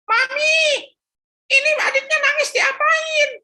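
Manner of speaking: put-on high loud childish call; boy yelling. Philip calling his mother from the baby's room, high loud child yell. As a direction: shout to mum from the bedroom. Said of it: "dia teriak".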